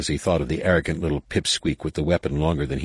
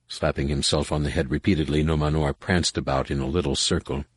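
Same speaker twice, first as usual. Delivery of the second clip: plain reading voice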